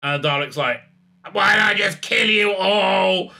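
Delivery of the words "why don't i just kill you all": slow, louder robot-monster voice; a menacing, slowed alien machine; Dalek voice. Retold or imitated. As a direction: grind it out like a Dalek. Dalek answers.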